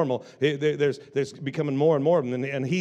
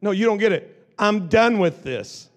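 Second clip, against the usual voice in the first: slow, louder, each word weighed; firm declaration; 6 semitones higher, 5 dB louder than usual. Acted. Beggar throwing off his garment, slow firm louder declaration. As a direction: declare it with rising resolve.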